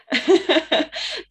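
high, loud burst of laughter; bright laugh. Real, not acted. Laughing at having missed God's message. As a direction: laugh at yourself, loudly. Laughing at her own slowness.

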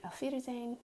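put-on Auf Wiedersehen spoken high, coaching a child; German phrase said for child. Shown as a demonstration. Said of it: "Nina, tell everybody".

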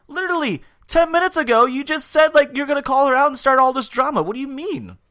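very high, loud incredulous outburst; exasperated rant. Real, not acted. Host answering back at Nicole's confessional, loud exasperation. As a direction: call out the hypocrisy in disbelief.

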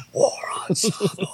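high-pitched mock stage yell; imitated metal scream. Singer suddenly screaming at the crowd, mock yell. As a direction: yell the song title without full volume. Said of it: "then he starts screaming at you".

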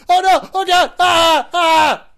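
loud high panicked screaming; being-eaten scream. Shown as a demonstration. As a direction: scream while being devoured. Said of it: "a mimic's eating scream".

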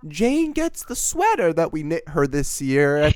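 lightly high, slow mom voice; a hint of a sweet mom; sweet parent voice.

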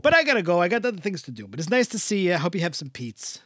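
put-on thick Jersey Italian accent; Italian-American accent. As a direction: play a warm old Italian uncle. Held Italian-American character voice.

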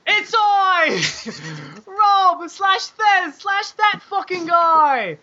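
very high, drawn-out hammy proclamation; grand mock announcement. Host performing absent friend's email himself, hammy announcement. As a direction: announce the name slowly, way up high.

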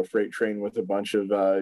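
ordinary delivery

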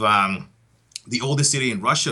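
normal talking voice

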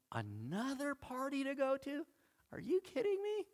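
very high, incredulous squeal; exaggerated can't-believe-it whine; mock disbelief. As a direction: act overwhelmed, pitch soaring in disbelief. Acts out overloaded Christmas partygoer.

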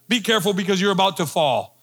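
normal talking voice

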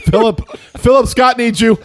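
loud, high mock call for help. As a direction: shout the name across the room. Calling out for the agent.

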